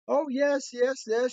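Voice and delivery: high-pitched, gushing relief; imitated overjoyed lady. Happy owner hears her dog is found, imitated joy. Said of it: "she's so happy that my mom's got her dog".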